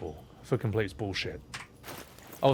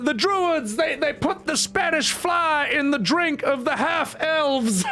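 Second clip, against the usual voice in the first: high, slow, wobbly Cosby voice; a hammed-up Bill Cosby reading lore; 13 semitones higher, slower than usual. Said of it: "Bill Cosby".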